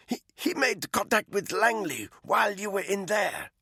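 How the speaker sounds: a frightened captive pleading; captive woman's voice. Acted. Malinka explains Langley contact.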